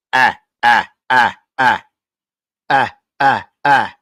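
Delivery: low, punchy repeated "ah"; mimicked alert noise. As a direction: bleat out short, evenly spaced low ahs. Mimics donation alert sound.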